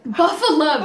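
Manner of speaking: excited. Real, not acted. Echoing cheer for hometown.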